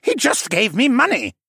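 high whiny excuse; whining. A narrator's reading. Assassin excuses himself to his interrogator, whining. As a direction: whine in self-defence. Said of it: "the assassin wannabe whined".

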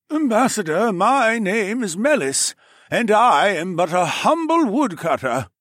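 humble woodcutter. Acted. Woodcutter Melis greeting the ambassador, high meek voice.

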